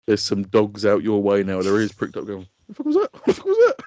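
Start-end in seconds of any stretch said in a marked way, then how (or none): 2.7-3.8 high, startled yelp of a question; high-pitched alarmed mock reaction; startled dog. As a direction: squeak it out like a dog with its ears pricked. Acts out startled dogs reacting.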